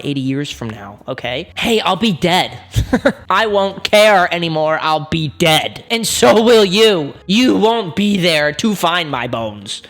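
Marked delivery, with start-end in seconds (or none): 3.3-9.8 loud, slow, punched words; mock-heated, deliberate declaration; emphatic retort. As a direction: hammer each word home. Arguing back at the meme.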